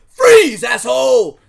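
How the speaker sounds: loud cop yelling Freeze; cop shouting. Retold or imitated. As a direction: bark it like a cop with a gun. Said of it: "like a cop with a gun out saying".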